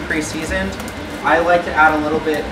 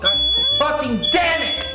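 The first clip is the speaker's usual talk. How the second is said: frustrated curse; 11 semitones higher, slower than usual. Real, not acted. Fire alarm goes off.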